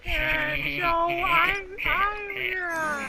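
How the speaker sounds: squeaky cartoon boy, dawdling on the last word; doing a goofy cartoon kid for friends; Carl Wheezer. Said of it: "He's like".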